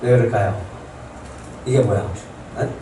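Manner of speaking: ordinary delivery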